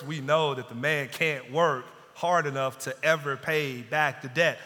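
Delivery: ordinary talk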